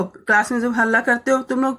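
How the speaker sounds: normal talking voice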